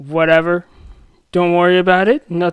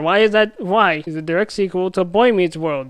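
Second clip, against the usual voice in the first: usual speaking voice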